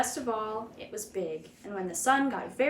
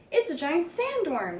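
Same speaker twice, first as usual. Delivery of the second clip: higher, alarmed cry; a light frightened shriek; 7 semitones higher than usual. A narrator's reading. Second beachgoer shrieking at the seaweed creature. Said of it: "shrieked another".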